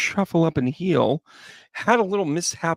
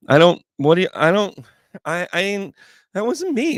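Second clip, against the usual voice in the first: flustered protest; 6 semitones higher than usual. Acted. Acts out flustered employee's protest.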